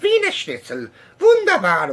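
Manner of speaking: mock a tiny Bavarian German henchman; high-pitched Bavarian German accent. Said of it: "Bavarian German accent".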